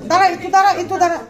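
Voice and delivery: high loud call to wait. Real, not acted. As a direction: shout for them to hold on. Calling out to stop someone.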